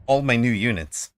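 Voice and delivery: ordinary delivery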